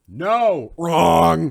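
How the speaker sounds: loud, high, drawn-out rejection; emphatic buzzer call. Real, not acted. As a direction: shout it like a game-show buzzer. Calls out a wrong answer.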